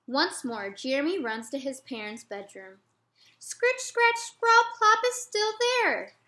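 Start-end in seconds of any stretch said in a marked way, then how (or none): 3.4-6.2 put-on much higher and louder child's protest; a frightened boy insisting the noise is back; upset little boy. Jeremy waking his parents again, high loud child protest.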